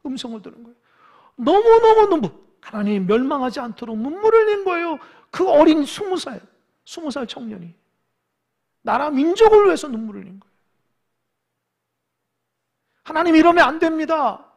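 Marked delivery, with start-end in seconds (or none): 1.4-10.5 loud, high bursts of sermon fervour; impassioned preaching. Acted. Preacher on a young prophet weeping for his people, impassioned preaching. 13.0-14.5 loud, high-pitched cry of protest; desperate plea. Acted. Prophet pleading with God for his nation, desperate cry.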